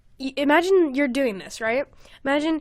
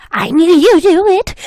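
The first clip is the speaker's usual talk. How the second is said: higher and louder, a lightly voiced creepy kid; young voice; 6 semitones higher, 8 dB louder than usual. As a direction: sing it out like an eerie child. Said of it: "I heard a young voice".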